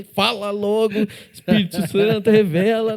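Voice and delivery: a touch of hammed-up urgency; urgent retold prayer. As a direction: demand the Holy Spirit reveal it now, laughing.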